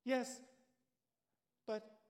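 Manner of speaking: clinging on and calling upward in relief; stranded climber answering the voice above him, frantic; frantic Jack. Acted.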